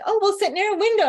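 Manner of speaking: high, quick chirpy voice; mock eager. Mocking others who want the window light, high and chirpy. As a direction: chirp it brightly like an eager show-off. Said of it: "everybody else is like".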